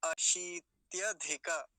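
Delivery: अशीत्यधिक with "shee" held long; अशीत्यधिक drawn out on the long vowel. Shown as a demonstration. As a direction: stretch the ī in अशीत्यधिक slowly. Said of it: "लंबा खींच के बोलेंगे थोड़ा सा".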